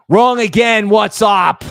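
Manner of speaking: loud shouted show opener. Acted. Host greets viewers with a loud shout.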